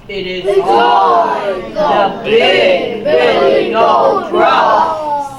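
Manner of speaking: loud, slow, mighty announcement; strong voice. Shown as a demonstration. Big goat answers the troll, strong voice. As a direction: declare it big and drawn out. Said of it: "say this in a strong voice".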